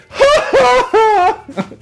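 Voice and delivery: high, loud burst of laughter; loud cackle of delight; big laugh. Real, not acted. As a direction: laugh out hard at the memory. Laughing at retold reaction.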